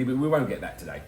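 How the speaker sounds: ordinary talk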